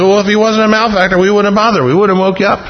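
high-pitched, apologetic excuse-making; sheepish. Acted. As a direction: plead sheepishly for having bothered him. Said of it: "And they kinda sheepishly".

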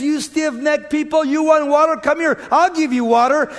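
high, loud preacher's imitation of a yell; angry shouting. Moses to the thirsty people at the rock, acted angry shouting. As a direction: shout it like a fed-up leader. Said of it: "he started shouting and yelling and screaming".

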